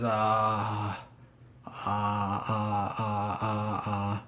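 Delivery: mock-stumped string of soft, drawn-out uhs; stumped stalling. Retells rival stumped for an answer.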